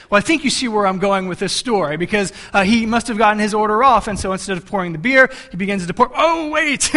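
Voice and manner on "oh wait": high, startled oh wait; sudden oops. Retold or imitated. Preacher acting out the cook's startled catch, high surprised. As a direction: catch the mistake mid-pour. Cook notices the mistake.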